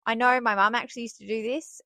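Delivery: ordinary talk, nothing marked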